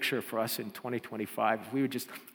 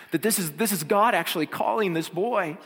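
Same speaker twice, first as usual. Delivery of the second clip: ordinary talk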